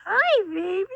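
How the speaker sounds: high, loud cooing greeting. Real, not acted. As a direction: coo hello to the kitten. Greeting the kitten.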